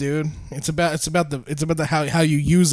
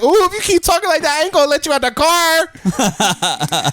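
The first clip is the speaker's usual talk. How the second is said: imitated woman's warning; 10 semitones higher than usual. Retelling the lady's car warning as punchline, high mock voice. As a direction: go high, stretch out the ending.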